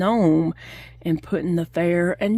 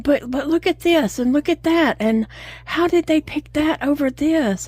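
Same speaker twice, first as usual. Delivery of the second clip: put-on upset kid; 8 semitones higher than usual. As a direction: mimic the boy protesting the judging, pitched up. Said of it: "And he's like".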